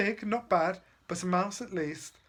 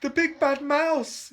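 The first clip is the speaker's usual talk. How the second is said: high, loud, slow yell; panicked little Gruffalo yell; 10 semitones higher, 7 dB louder, slower than usual. Acted. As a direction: yell it out in terror. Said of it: "yelled the Gruffalo's child".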